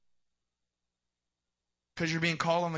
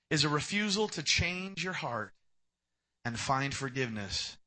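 ordinary delivery

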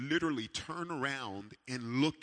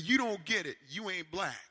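heated retort; 6 semitones higher, 4 dB louder than usual. Retold or imitated. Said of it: "I said".

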